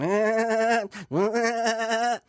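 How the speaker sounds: high, loud crooning ahs; mock singer. Imitating Paddy Riley on TV, high, loud crooning. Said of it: "And Paddy's going".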